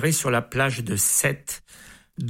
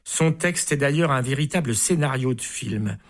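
plain reading voice

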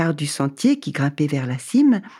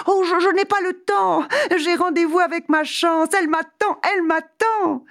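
giddy hurried traveller; 13 semitones higher than usual. Acted. Same hurried refusal.

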